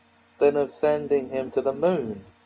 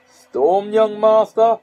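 booming laugh-roar, much higher and louder; 9 semitones higher, 8 dB louder, slower than usual. Acted. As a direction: roar it out like a hearty sea captain. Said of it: "he roared".

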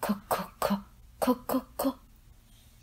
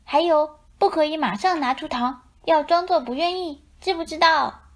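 bossy instructing; 6 semitones higher than usual. A narrator's reading. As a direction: lay down the rules like a little boss. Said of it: "小羊们吩咐他".

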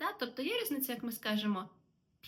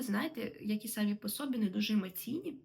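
usual speaking voice

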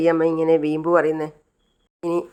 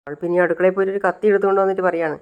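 plain reading voice

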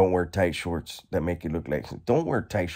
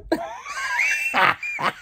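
shrieking laugh. Real, not acted. After asking if it was a big one, a shrieking laugh. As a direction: laugh shouted out very high.

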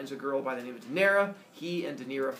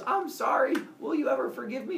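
pleading centaur; 10 semitones higher, 3 dB louder than usual. Acted.

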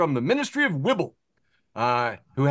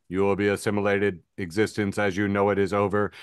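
low, even, robotic Borg drone; Borg cube voice; 8 semitones lower than usual. Retold or imitated. Meme Borg cube announcing doom in a low drone. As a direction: intone it like an unfeeling hive mind. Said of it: "and it says".

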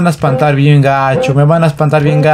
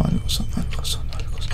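fast hushed repeats; nervous whisper; 13 dB quieter, much faster than usual. Real, not acted. Player who heard a sound, whispering it nervously. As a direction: whisper it quickly, listening for the noise.

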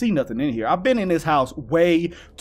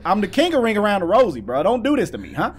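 ordinary delivery

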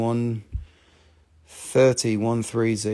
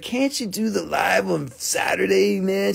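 imitating Nicole; 5 semitones higher than usual. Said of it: "She said it just like that".

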